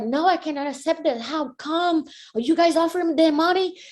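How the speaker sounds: high, indignant rapid questioning; acted-out outraged demand; angry protest. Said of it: "I've been crazy angry".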